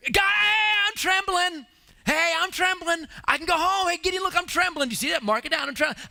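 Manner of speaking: high, loud, hammed-up eager soldier; comic soldier shouting up high that he's trembling. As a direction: wave your hand and beg to be picked to go home. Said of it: "any of the guys were like this".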